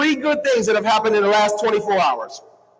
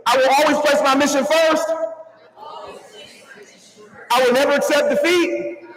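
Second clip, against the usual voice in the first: raised, deliberate creed recital for the crowd to echo; reciting a creed; 4 semitones higher than usual. Shown as a demonstration. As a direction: lead the line so the room can repeat it. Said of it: "let's say it together".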